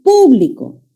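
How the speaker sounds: PÚ-bli-co, drawn out for the class; stress on antepenultimate syllable. Shown as a demonstration. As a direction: hit the first syllable of "público". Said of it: "en la antepenúltima sílaba".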